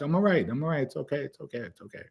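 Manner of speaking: retold reassurance, fading away to almost nothing; hushed, repeated calming of a grandmother; soft reassurance.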